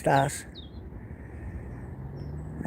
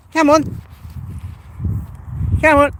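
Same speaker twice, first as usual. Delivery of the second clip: calling the dog; 11 semitones higher than usual. Real, not acted. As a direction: call out sharply to your dog.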